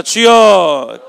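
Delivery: long drawn-out loud cry of 주여. Shown as a demonstration. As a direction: call out 주여 slowly at full voice. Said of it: "큰 소리로 따라해서". (크게 길게 부르짖는 주여)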